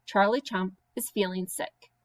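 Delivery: usual speaking voice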